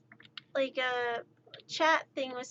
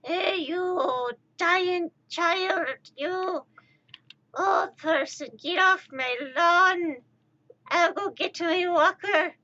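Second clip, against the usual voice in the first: put-on higher, drawn-out old-timer voice; grumpy old person; 4 semitones higher, slower than usual. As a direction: put on a cranky old lawn-guarder's voice. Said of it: "sound, like, overly old to people".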